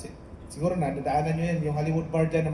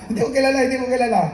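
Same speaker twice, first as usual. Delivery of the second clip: laughing; 7 semitones higher, 6 dB louder, much faster than usual. Retold or imitated. Retold puzzled reaction to gift.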